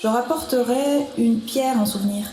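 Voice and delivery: normal talking voice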